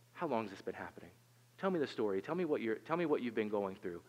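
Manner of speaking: soft, gentle, unhurried; caring doctor. Retold or imitated. Doctor gently asking a worried parent, soft and caring. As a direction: coax the story out kindly.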